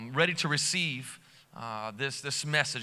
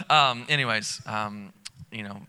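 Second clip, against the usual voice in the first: normal talking voice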